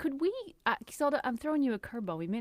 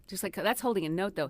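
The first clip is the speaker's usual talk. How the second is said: normal talking voice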